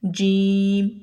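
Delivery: جیم said clearly with the tongue touching the palate; letter jeem. Shown as a demonstration. Teacher modelling the letter جیم for students. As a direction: model جیم slowly and distinctly. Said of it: "پھر جیم کی آواز نکلتی ہے".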